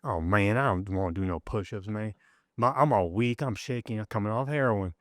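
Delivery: imitating a roommate's Southern drawl; lightly put-on Southern accent, worn-out and reluctant. Roommate begging off push-ups in detox, Southern drawl. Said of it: "he had a Southern drawl".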